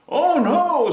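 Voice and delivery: high, loud dismayed cry; a startled "Oh no"; alarmed father. A narrator's reading. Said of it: "said her father".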